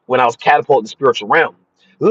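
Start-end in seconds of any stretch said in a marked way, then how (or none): 2.0-2.1 high wailing imitation of a tormented siren; drawn-out siren cry, rising and falling. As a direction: wail out a long siren "ooh". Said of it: "That's the sound I heard".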